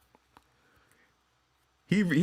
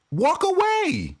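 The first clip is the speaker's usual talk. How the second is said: exasperated shout; slower than usual. Acted. Vlogger yelling advice at LeBron, exasperated. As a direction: yell it in disbelief.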